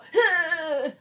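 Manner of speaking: loud, high strained squeal; panicked noise of running out of air; mock freak-out noise. Shown as a demonstration. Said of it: "you're gonna wanna go".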